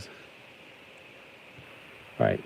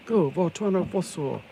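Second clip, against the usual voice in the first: cartoon hero call; 8 semitones higher, slower than usual.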